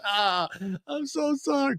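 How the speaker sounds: high, soft whiny apology; mock sorry drunk. Imitating a drunk ex's voicemail apology, high and soft. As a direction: play the pathetic drunk caller for laughs.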